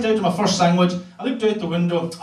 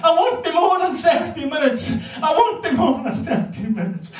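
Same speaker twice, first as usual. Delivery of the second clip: hammed-up deathbed granny; dying granny; 10 semitones higher than usual. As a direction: wail it like a granny on her deathbed. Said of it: "She said to me".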